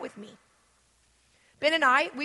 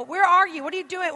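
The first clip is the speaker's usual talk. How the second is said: higher, louder demanding questions; mock nagging text; 5 semitones higher, 7 dB louder than usual. Imitating an angry texter, higher and louder. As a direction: fire off needy text questions. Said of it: "They'll text you".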